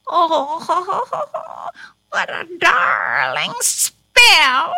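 cackling witch, gleeful and triumphant; crowing. A narrator's reading. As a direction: crow with delight over your wicked trick. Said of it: "she crowed".

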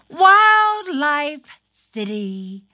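plain reading voice

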